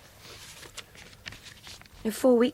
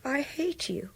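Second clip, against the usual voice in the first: quiet hatred; 2 semitones higher, 7 dB quieter, slower than usual. Acted. Captive spits hatred at captor.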